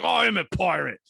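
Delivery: Pirate Paul boasting to visiting sailors, hammed-up high pirate voice. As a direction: puff it up like a jolly pirate. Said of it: "he's like".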